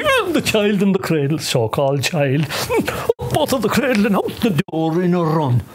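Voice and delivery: quick and lively climax; excited storytelling. A narrator's reading.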